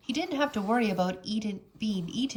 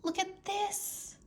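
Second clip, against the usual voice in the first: high, soft and slow; hushed, full of wonder; slow hushed awe; whispered; 11 semitones higher, 5 dB quieter, slower than usual. A narrator's reading. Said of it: "she whispered".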